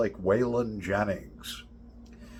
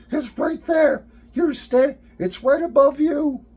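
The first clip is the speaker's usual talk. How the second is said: much higher and louder, a squealing panic; terrified girl; 8 dB louder than usual. Retold or imitated. Daughter shrieking to dad about the spider on her ceiling.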